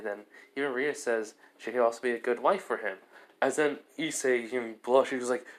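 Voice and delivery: ordinary delivery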